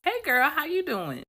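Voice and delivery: put-on very high bright girlfriend greeting; perky greeting. Greeting a friend she ran into, very high perky voice. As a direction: squeal hello to an old friend. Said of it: "here's my response".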